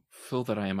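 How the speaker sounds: ordinary talk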